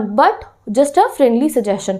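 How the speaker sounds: usual speaking voice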